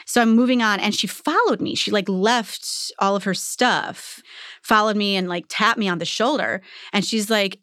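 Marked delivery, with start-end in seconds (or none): none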